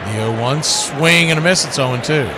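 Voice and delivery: play-by-play. Acted. Calling the game play-by-play.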